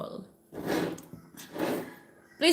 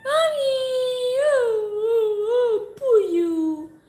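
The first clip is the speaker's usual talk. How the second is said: much higher, loud whiny coo at the cat; 13 semitones higher, 6 dB louder, slower than usual. Real, not acted. Cooing at her cat.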